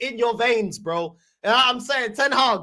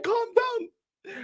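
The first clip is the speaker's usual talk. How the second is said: "Calm down." said higher and softer, mock soothing; a teasing high "Calm down."; 10 semitones higher, 5 dB quieter than usual. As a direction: imitate a man pleading "Calm down." in a raised voice. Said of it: "A man saying".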